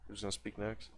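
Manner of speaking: ordinary delivery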